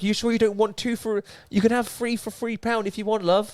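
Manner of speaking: very high, put-on shop lady voice; Asda shop worker. Asda worker offering a deal, high imitated local voice. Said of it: "when I was in Asda".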